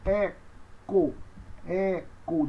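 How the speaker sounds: "eco" split into two slow syllables; pronunciation demo. Shown as a demonstration. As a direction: pause between the syllables of "eco". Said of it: "Então, nós temos eco".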